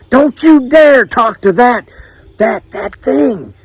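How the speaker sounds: high, slow, appalled mother; scolding mama. A narrator's reading. Mama stopping her son going to the stranger, high scolding voice. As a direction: forbid it, stretching the words in disgust.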